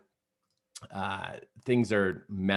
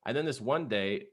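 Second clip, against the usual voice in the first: ordinary talk, nothing marked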